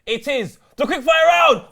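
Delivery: slow, high, booming segment call; shouted announcement. Acted. Host announcing the quick fire round, slow high shout. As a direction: yell it, stretching the final word.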